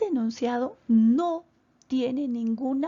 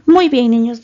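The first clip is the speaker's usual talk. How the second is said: calling out loudly; 16 dB louder than usual. Real, not acted. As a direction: raise the voice to call the class back. Teacher calls class to attention.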